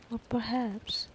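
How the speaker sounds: ordinary delivery, nothing marked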